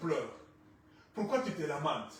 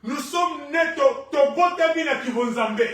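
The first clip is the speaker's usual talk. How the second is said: raised, ringing declaration to the congregation; forceful preaching; 7 semitones higher, 8 dB louder than usual. Real, not acted. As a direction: proclaim it loudly, stretching key words.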